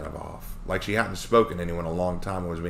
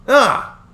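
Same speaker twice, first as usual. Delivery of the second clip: high, loud startled scream; sudden frightened cry; 12 dB louder than usual. Acted. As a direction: yelp in fright. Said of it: "My scream".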